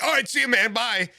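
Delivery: high, abrupt mock farewell; hurried getaway goodbye. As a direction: toss out a high-pitched bye as you flee. Retells bolting from the stranger.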